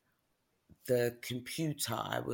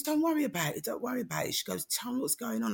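high-pitched, quick brushing-away of apologies; retold reassurance; 10 semitones higher, faster than usual. Doctor reassures crying patient, retold high reassurance. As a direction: wave the worry away, voice lifted.

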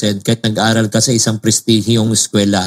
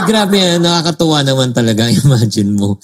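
usual speaking voice

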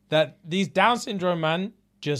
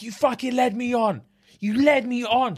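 higher, imitated tearful accusation; mock crying; 8 semitones higher than usual. Retelling a dumped man's breakdown, higher mock tearful voice. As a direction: play his breakdown, half-hearted sob. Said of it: "He's crying his eyes out".

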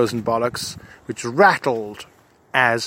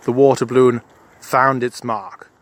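normal talking voice

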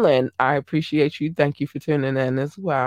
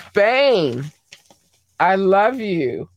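usual speaking voice

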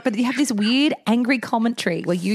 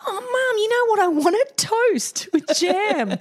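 put-on high, sing-song kid asking, slow at the end; lightly voiced chirpy child; 13 semitones higher, slower than usual. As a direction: play the boy making a late request. Voicing son at school.

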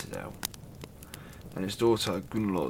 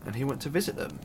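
ordinary delivery

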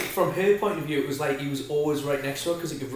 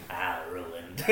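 lower, quieter cartoon duck with a laugh; duck impression; 7 semitones lower, 6 dB quieter than usual. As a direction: mumble it like the little duck. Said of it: "he goes".

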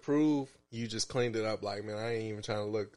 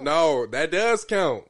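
loud insistent; 4 semitones higher, 10 dB louder, much slower than usual. Real, not acted. Arguing point.

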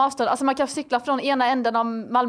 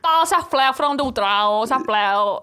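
drawn-out, hammed-up Värmland accent; clumsy Värmland impression, on request; Värmland dialect; slower than usual. Shown as a demonstration. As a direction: badly fake a slow Värmland dialect. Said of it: "Prata lite värmländska".

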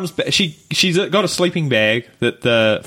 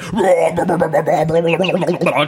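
mock gibberish tongues. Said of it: "talking in some sort of weird tongue thing".

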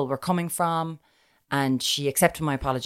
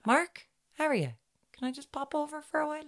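high, sugary pretend call to an ex; mock sweet greeting; 11 semitones higher, 7 dB quieter than usual. Pretending to ring her ex-boyfriend in Canada, high mock sweetness. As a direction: put on a falsely bright voice for the ex.

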